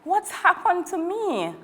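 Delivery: put-on girl after being put down; bewildered. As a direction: wonder aloud, dazed.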